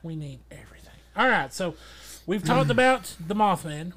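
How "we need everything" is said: soft breathy whispered echo; whispering. Acted. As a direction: whisper it back. Echoing co-host.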